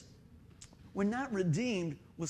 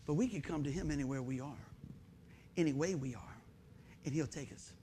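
tender; 7 semitones lower, 8 dB quieter than usual. Real, not acted. Pastor softens to congregation.

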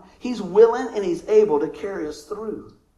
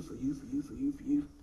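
whispered, softer, lower, repeating gently; hushed whisper; 5 semitones lower, 9 dB quieter than usual. Acted. Jesus softly offering to pay the price for everyone, whispered. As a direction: breathe each "for you" as a tender offering.

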